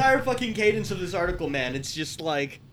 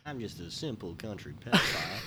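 mock country bumpkin; 10 semitones lower, 10 dB quieter than usual. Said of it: "That wasn't Wayne Dupree".

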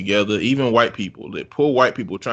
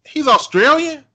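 surprised; 6 dB louder than usual. Real, not acted. Shocked at Hugh Laurie claim.

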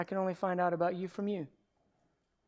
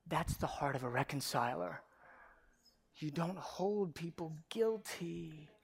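whispered; 2 semitones lower, 7 dB quieter than usual. Acted. Preacher hushes a key line.

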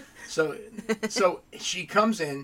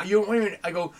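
usual speaking voice

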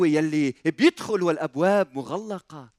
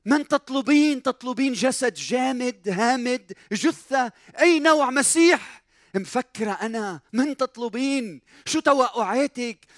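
high, insistent questioning; challenging reproach; 5 semitones higher than usual. Acted. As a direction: challenge her with pressing, raised-pitch questions. Acts out Jesus challenging Mary. (استجواب معاتب بنبرة مرتفعة)

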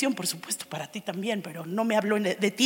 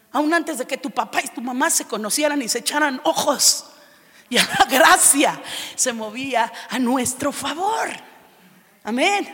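rising to a louder preaching shout; preaching at full force; 6 dB louder than usual. Acted. Preacher to the church, building to a full shout. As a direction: drive it home to the congregation.

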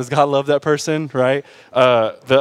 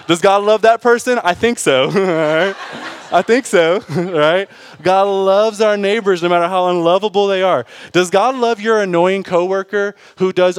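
laughing preaching; 6 semitones higher, 4 dB louder than usual. Acted.

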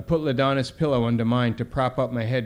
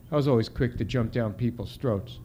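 ordinary delivery

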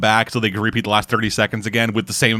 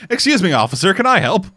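mock polite friend; 7 semitones higher than usual. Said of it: "does Pakistani friend say".

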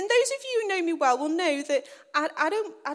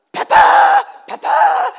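very high, loud shouts for a lost dog; frantic calling; 13 dB louder, much slower than usual. Retold or imitated. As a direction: yell the name as if searching in panic.

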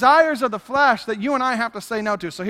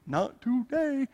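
very slow, softer "Not today"; "Not today" slowly drawn out, teasingly firm; drawn-out refusal; 7 dB quieter, much slower than usual. Acted. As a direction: stretch out each syllable of "Not today". Repeats refusal to flesh, drawn out.

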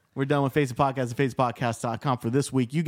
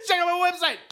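high, pushy mock shout; high mock fighter voice; 14 semitones higher, faster than usual. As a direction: shout it like a cheesy hype man. Imitating fighters hyping themselves.

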